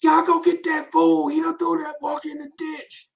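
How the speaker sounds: high, loud mock grandma wail; grandma's cry. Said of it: "had his grandma crying".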